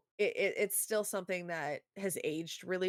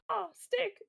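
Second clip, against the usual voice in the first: much higher, gleeful yelp; mock excited dog-boy. Boy spotting the thrown stick, excited. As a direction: leap on it like an eager dog. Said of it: "the guy was like".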